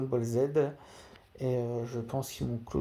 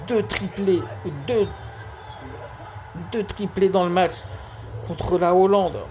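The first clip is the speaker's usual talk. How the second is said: excited; 6 semitones higher, 6 dB louder than usual. Acted. Commentator hails a double hat-trick.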